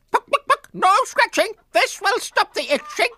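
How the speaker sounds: very high, bossy clucking hen voice; clucking nurse chicken. Acted. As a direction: bustle in like a fussy hen nurse. Nurse chicken Florence bustles in.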